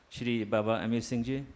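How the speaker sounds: ordinary talk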